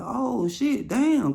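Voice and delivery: way up high, startled put-on voice; mock woman surprised. Older woman seeing him on video, high surprised squeal. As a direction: squeal it like she's shocked.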